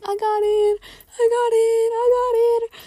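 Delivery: loud, very high repeated squeal; re-enacted joyful crying. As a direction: cry it out over and over, overjoyed. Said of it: "I just kept saying that".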